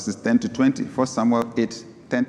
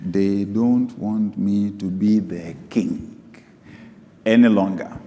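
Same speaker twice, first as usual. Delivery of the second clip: very slow, weighted scripture reading; 5 semitones lower, slower than usual. Shown as a demonstration. Preacher rereads God's words to the congregation, very slowly. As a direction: draw each word out slowly. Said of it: "Let me read this slowly".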